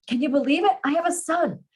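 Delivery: high, elated amazement; bursting with happy excitement; excited joy. A narrator's reading. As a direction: gush it in joyful disbelief. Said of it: "his increasing excitement".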